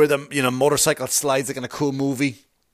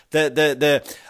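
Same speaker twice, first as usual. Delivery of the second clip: ordinary delivery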